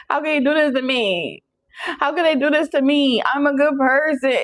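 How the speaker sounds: mock upset whine. Venting to a best friend on the phone, mock wounded whine. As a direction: play the wronged friend venting.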